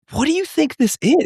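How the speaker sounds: retold disbelief, pitched very high; squeaky, exasperated rhetorical question; incredulous. Father incredulous at watched visit, very high-pitched disbelief.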